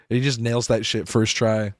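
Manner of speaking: normal talking voice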